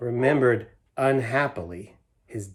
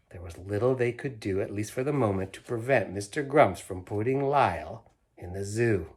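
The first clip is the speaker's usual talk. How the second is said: ordinary delivery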